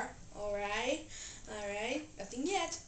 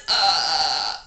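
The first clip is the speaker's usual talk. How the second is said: loud burp; 9 semitones lower, 9 dB louder than usual. Acted. Burping contest, burps.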